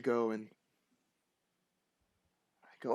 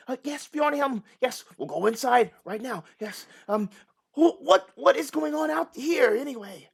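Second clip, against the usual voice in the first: much higher, flustered townsman; nervous villager; 9 semitones higher, 6 dB louder than usual. Acted. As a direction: squeak it out, eager to obey.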